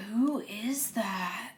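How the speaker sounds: slow hushed whisper. Retold or imitated. Peering at friends in the dark, slow whisper. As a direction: breathe it out, unsure. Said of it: "I'm like".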